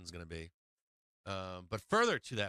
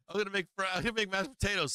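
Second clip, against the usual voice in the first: mock kid voice; 7 semitones higher, much faster than usual. Kids voiced demanding food.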